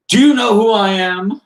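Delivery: high loud outraged royal demand; indignant prince. Acted. Prince Vince pounding on the mayor's door, high indignant demand. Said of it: "the voice I was doing for Prince Vince".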